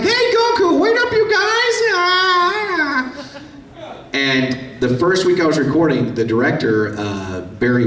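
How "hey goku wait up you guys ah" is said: very high, squeaky boy cartoon voice; original Krillin voice. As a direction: pitch way up into a tiny squeaky kid. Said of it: "the original Krillin sounded like this".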